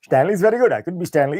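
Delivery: mock-frightened, eager to agree; acted panicky backdown. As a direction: cave in, breaking into laughter. Threatened waiter gives in, snootiness gone.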